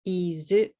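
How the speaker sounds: "Izu" in two beats, ending on a u; Japanese-style IS, a vowel added after the z; katakana English. Shown as a demonstration. Teacher counting syllables for learners, katakana English. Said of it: "two syllables".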